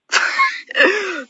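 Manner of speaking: a sudden high burst of laughter. Real, not acted. Laughs before story.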